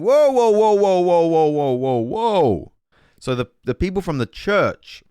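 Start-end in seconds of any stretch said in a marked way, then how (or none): 0.0-2.7 high, loud string of alarmed whoas; mock scribes objecting. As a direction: jump in loudly to stop him. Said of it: "they are saying".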